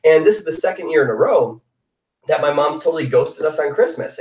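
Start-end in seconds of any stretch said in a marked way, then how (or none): none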